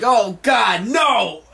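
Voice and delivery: high, panicked shout of horror; terrified shout. Retold or imitated. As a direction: scream it like you're being chased. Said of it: "And they're like".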